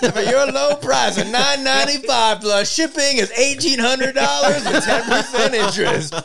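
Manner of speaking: high, slow hammed-up TV salesman; home shopping host. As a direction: pitch it like a late-night infomercial. Spoof sales pitch for toys.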